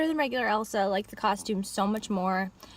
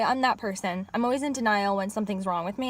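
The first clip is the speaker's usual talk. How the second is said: ordinary delivery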